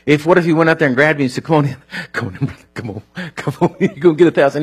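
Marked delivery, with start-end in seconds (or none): 1.4-4.6 fast, chuckling come-on; a giddy, rushed come on in; laughing invitation. Acted. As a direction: coax him in fast while laughing. Acted stranger pulling man inside.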